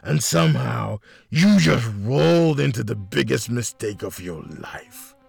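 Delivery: gravelly menacing villain taunt; hostile villain. A narrator's reading. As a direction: sneer and threaten with contempt. Villain taunting cornered officer.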